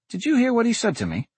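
high, quick indignant question; can you believe him; indignant disbelief. A narrator's reading. Dante indignant at boy.

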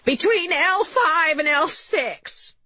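high, drawn-out wailing mother voice; interfering mother. A narrator's reading. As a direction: butt in from the doorway, high and slow.

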